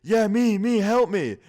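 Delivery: loud, high cry for help from outside; yelling for help. Retold or imitated. Stranger in the backyard yelling up to the house for help. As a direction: yell it from across the yard. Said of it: "He was yelling".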